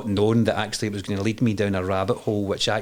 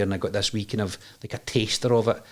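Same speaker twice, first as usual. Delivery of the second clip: ordinary talk, nothing marked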